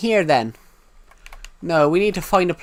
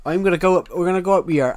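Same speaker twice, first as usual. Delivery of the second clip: a louder, lightly thickened regional UK accent; thicker UK accent; 3 semitones higher, 4 dB louder, faster than usual. Shown as a demonstration. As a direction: push his UK accent a bit thicker. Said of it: "this is actually what my accent would be if it was actually thicker".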